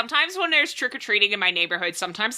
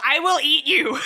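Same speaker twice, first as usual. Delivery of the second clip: mock scream; 8 semitones higher than usual. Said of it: "She screams at".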